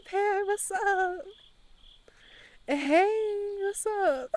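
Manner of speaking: high, drawn-out hello; sing-song greeting. Real, not acted. Streamer greeting a returning viewer, high sing-song.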